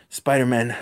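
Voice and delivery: usual speaking voice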